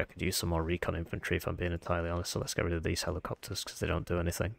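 ordinary talk

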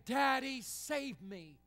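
put-on a child's cry to daddy, high and loud at first; anguished plea. Jesus in the garden begging his father, anguished plea. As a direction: beg your father to save you. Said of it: "praying to his father and saying".

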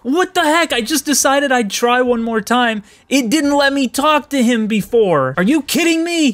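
high, loud disbelief; exasperated high-pitched disbelief; incredulous. Real, not acted. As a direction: squeal it in outraged surprise. Game now allows talk.

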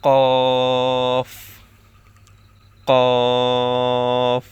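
loud, long কফ from the tongue root; tongue-root letter. Shown as a demonstration. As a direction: hold কফ out, back of tongue against the palate. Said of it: "জিহ্বার গোড়া".

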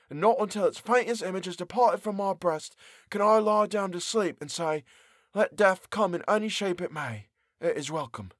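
high, sorrowful murmur to himself; mournful. Acted. Prisoner talks to himself in the vault, mournful. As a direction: resign yourself to it mournfully. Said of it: "he said to himself mournfully".

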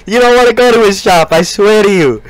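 high, pushy infomercial rant; hard sell against the rival; cheesy TV pitchman, amped up; hyped sales pitch. Pretend.

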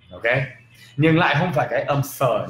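normal talking voice

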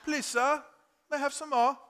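high-pitched English orphan begging; English accent. Acted. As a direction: plead politely in a put-on English accent. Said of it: "Does that sound right?".

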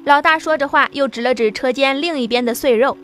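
usual speaking voice

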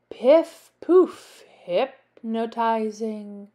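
plain reading voice

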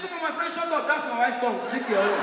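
loud, high indignant outburst; scolding uncle. Acted. Uncle catching nephew copying his wife's tongue, indignant scolding. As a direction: cut him off at high volume.

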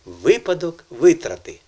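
"випадок" and "витрати" with each ВИ punched; correct first-syllable stress. Shown as a demonstration. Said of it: "Запам'ятайте".

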